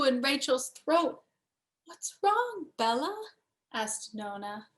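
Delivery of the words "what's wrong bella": higher, different-voiced worried grandma; grandma's concern. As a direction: ask gently, a touch alarmed. Nona sees Rachel upset.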